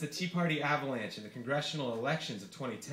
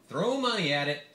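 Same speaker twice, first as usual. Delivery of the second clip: scoffing candidate voice, a touch louder; Santorum voice; 4 semitones higher, 4 dB louder than usual. Acted. Senator mocking liberal spending to a reporter, scoffing. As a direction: sneer at spending. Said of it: "said Santorum".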